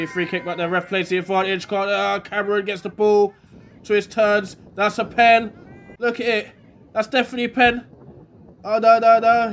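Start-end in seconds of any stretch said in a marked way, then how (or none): 4.7-9.5 higher and louder, insisting on the penalty; excited penalty appeal. Real, not acted. Commentator claiming a penalty for his team, excited. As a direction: certain it's a foul, then letting it go.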